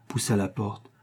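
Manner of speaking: plain reading voice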